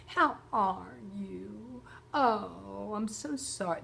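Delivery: slow, drawn-out mock sympathy; a hint of a gushing sympathetic caller; pitying caller. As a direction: imitate a pitying friend lightly. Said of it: "when people call and go".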